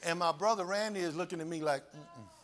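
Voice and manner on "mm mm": quiet warning hum through closed lips; a low hush to warn him off. Retold or imitated. Brother warning him hush.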